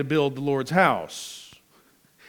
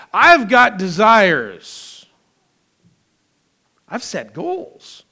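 proud excuses; 4 semitones higher, 8 dB louder than usual. Retold or imitated. Voicing self-focused hearts.

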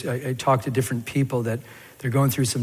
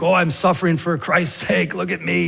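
self-important boasting; 4 semitones higher than usual. Acted. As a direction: play the show-off sufferer. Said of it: "and then you think".

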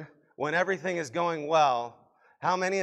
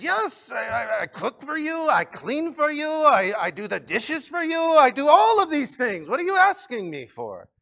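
high-pitched, indignant wife listing chores, getting louder; exasperated wife; 10 semitones higher, 8 dB louder than usual. Acted. Wife answering husband's question about love, exasperated. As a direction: protest in a high voice, hammed-up exasperation.

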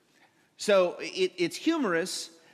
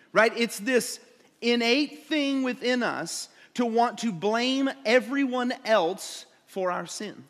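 ordinary talk, nothing marked

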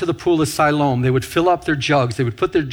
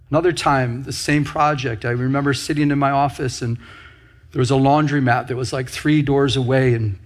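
usual speaking voice

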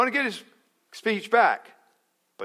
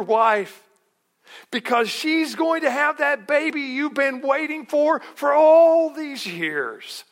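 booming high, loud angel voice; loud joyful herald announcing the baby; angel's message; 11 semitones higher, 8 dB louder than usual. Acted. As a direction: proclaim it like a jubilant angel. Preacher voices angel Gabriel's message.

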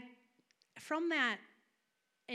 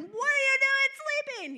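high, very loud shout; panicked yelling; 13 dB louder than usual. Acted. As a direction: yell in alarm to wake a sleeper. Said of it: "No, they're yelling".

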